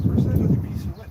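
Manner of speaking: shouting over table saw. Real, not acted. Calling out over shop noise.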